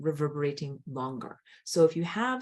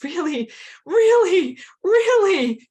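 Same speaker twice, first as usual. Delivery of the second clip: "really" pitched far higher and much louder each time; stressing really; 12 dB louder, slower than usual. Real, not acted. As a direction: lean hard on every "really". Stressing how deeply toddlers feel.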